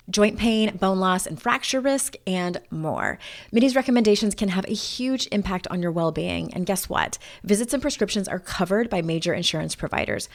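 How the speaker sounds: smooth, polished podcast sponsor read; lilting list-like commercial delivery; scripted ad read. Acted. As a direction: pitch the product in tidy, rehearsed phrases. Reads a sponsor ad.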